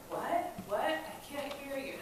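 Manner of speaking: high, questioning pretend deafness; mock can't hear. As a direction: act like you can't hear her. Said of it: "as if he couldn't hear her".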